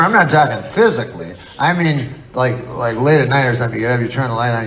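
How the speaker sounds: ordinary delivery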